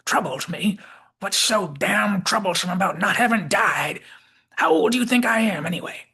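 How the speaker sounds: put-on irate old Southerner, high and loud; Southern McCoy. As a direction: rant in a cranky Southern accent. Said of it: "picked up some kind of Southern accent".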